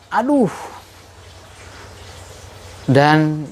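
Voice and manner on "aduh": high cry of ouch; sudden high ouch; shout of pain. Retold or imitated. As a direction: yelp as you fall. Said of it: "dia berteriak".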